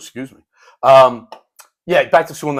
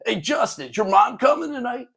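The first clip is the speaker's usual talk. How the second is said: mock yell; 10 semitones higher than usual. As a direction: imitate a kid calling across a classroom. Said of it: "yells out across the room".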